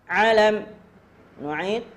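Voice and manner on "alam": high, loud model word; drill call. Shown as a demonstration. Teacher modelling a word for students, loud drill call. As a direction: call it out for a class to repeat.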